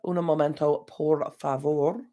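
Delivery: slow, drawn-out Spanish phrase; lightly hammed Spanish accent; mock Spanish. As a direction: stall playfully in Spanish accent. Stalling while finding calendar.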